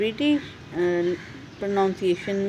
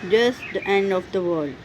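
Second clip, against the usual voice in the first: slow demo; much slower than usual. Shown as a demonstration. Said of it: "दोबारा से सुने".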